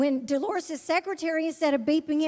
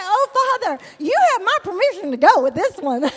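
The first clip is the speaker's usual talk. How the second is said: ecstatic exclaiming; 4 semitones higher, 9 dB louder than usual. Retold or imitated. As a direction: shout it like you just saw the most handsome man alive. Prays excitedly about the man.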